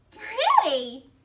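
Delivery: put-on loud, high delighted kid; delighted squeal. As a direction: squeal with joy. Child delighted.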